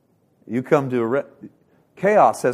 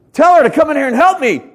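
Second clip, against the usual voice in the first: much higher and louder, a near-shrieked complaint; Martha's frantic demand; 12 dB louder than usual. Retold or imitated. Martha to Jesus about Mary, a loud high-pitched demand. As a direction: burst in yelling for help from the kitchen.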